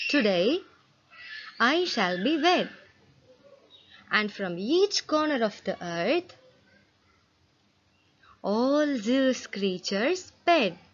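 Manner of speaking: measured, expressive classroom read-aloud; reading a poem to children. Acted. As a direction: read it like a storybook verse for the class. Reads poem stanza to class.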